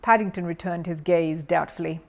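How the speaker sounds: ordinary delivery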